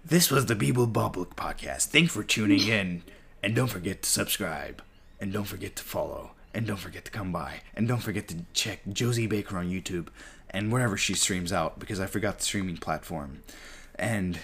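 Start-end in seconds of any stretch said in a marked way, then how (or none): 0.0-11.8 a lightly read sign-off, trailing softer; podcast outro. Acted. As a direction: run through the plugs like a closing announcement.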